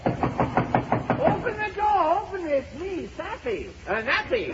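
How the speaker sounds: very high, distant call; comic high-pitched calling; calling through door. Acted. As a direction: plead to be let in, high and small. Napoleon calls from outside the door.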